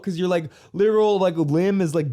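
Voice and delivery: ordinary talk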